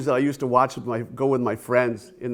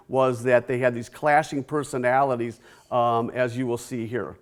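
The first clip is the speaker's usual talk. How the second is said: ordinary delivery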